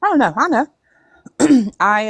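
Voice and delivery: ordinary delivery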